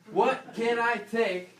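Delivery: high, slow, loud mocking echo; alarmed patient voice; mock panic. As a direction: parrot the panicked question, drawn out. Echoing audience, mocking the reflex.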